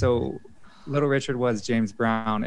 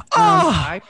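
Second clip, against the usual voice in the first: excited; 11 dB louder than usual. Real, not acted. Launching a bra story.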